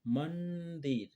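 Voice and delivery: मंदिर said slowly, each syllable stretched for the class; slow, drawn out word. Shown as a demonstration. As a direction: stretch मंदिर out slowly so children hear the न sound. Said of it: "म के बाद न आ रहा है".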